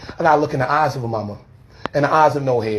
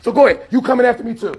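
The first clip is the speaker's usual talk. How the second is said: heated confrontation; 10 semitones higher than usual. Real, not acted. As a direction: push back hard, voice climbing with anger. Heated confrontation with church security.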